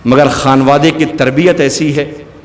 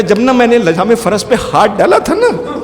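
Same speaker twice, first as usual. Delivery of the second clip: wailing confession; 12 semitones higher than usual. Acted. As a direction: cry it out in anguish. Hurr confesses, wailing in grief.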